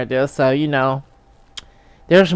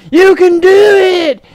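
high, loud, slow cheering yell; shouted encouragement; 7 dB louder than usual. Pretend. Audience member urging the winner on, hammed-up shout. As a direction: holler it from the crowd, drawn out. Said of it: "Then somebody gonna be like".

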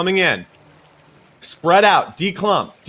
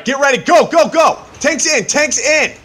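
excited callout; 9 semitones higher, 6 dB louder than usual. Real, not acted. Raid leader calling engage.

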